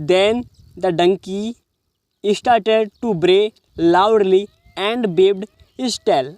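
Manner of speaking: very slow, every word set apart; slow reading aloud. Shown as a demonstration. Teacher reading the donkey sentence slowly to students. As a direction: read it out like dictation.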